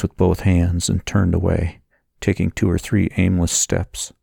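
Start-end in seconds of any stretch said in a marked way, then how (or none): none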